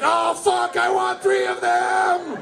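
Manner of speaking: high, slow, yelling craving; greedy mock excitement. Comic at home watching KFC ad, greedy shout. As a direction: lust after the sandwich on TV.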